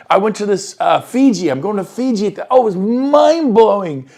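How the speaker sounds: hammed-up delight; gushing excitement. Shown as a demonstration. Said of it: "over-exaggerating".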